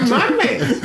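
laughing approval of friend's quip. Real, not acted. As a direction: laugh out the praise. Echoes through laughter.

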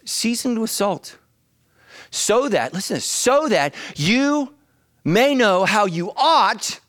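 slow, high, loud stressing of ought; preacher slowing to hammer the verse; drawn-out emphasis. Shown as a demonstration. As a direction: stretch every word and lean on ought. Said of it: "keyword ought".

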